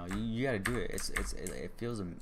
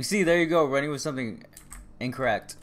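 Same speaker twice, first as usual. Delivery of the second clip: raised, higher-pitched protest; indignant retort; 4 semitones higher, 9 dB louder than usual. Real, not acted. Streamer objecting to being misquoted, loud indignant retort. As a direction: call out the mistake loudly.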